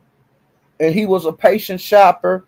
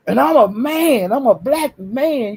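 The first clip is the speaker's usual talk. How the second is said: mock proud speech; 6 semitones higher than usual. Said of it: "he was talking like that".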